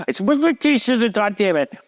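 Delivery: high-pitched defensive protest; acting out an exasperated husband's comeback; exasperated retort. Acted squabble with upset wife.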